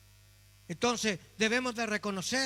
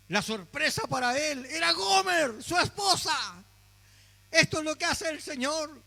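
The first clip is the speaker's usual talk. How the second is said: louder, higher, ringing sermon climax; full-force preaching; 6 semitones higher, 5 dB louder than usual. Acted. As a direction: preach it out to the congregation. (predicación a todo pulmón ante la congregación)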